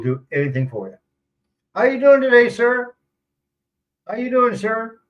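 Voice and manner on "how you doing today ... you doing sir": high, loud friendly greeting; imitating polite locals. Expat imitates locals greeting him, high and eager. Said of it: "that's how they talk to me".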